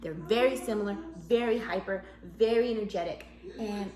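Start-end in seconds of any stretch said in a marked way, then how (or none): none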